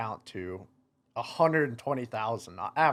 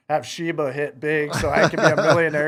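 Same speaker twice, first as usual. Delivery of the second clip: laughing at himself; 5 semitones higher, 11 dB louder than usual. Retold or imitated. Mocks his own crypto plan.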